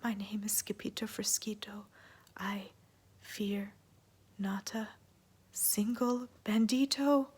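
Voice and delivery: soft unvoiced whisper, slowing at the end. Scapito whispering bravely to the bandit, very soft. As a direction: whisper your brave words while trembling. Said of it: "in a muy, muy soft voice".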